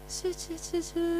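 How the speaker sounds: soft snoring noises gur and shu; imitated snoring. Pastor's father asleep at home: soft imitated snoring. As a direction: mimic a dad snoring in the morning.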